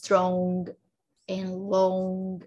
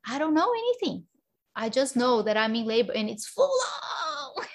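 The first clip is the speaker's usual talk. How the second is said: high, exclaimed protest in retelling; exasperated reply; 5 semitones higher than usual. Retold or imitated. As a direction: exclaim it, pitch jumping up as if overwhelmed. Exasperated reply to partner in labor.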